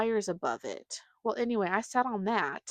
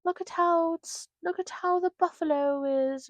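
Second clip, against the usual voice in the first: mock tour guide; 8 semitones higher than usual. Mocking sign reader voice.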